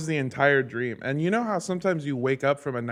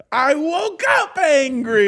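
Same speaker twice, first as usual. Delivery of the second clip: high, drawn-out mock shout; imitated angry scream; 10 semitones higher, 5 dB louder, slower than usual. Co-host imitating guest's morning outburst, mock angry scream. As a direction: shout it like a comic tantrum. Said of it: "Lindsay earlier screamed".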